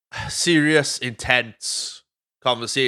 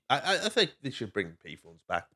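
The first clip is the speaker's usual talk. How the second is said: ordinary talk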